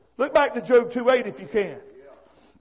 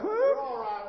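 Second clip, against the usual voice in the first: puzzled huh; 10 dB quieter than usual. Retold or imitated. Preacher mimics listeners doubting his story, puzzled. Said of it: "look at me like".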